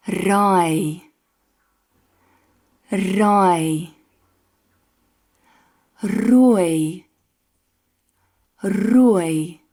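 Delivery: each word starting with a trilled R, said twice; rolled Afrikaans R. Shown as a demonstration. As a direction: roll the R at the start of every word. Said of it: "practice a few words".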